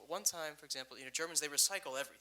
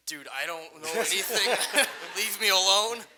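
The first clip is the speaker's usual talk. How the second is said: much louder, slow, laughing "leave me alone"; laughing exasperated outburst; 5 semitones higher, 15 dB louder than usual. Retold or imitated. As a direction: blurt it out, half laughing, half pleading. Retold exasperated reply to stranger.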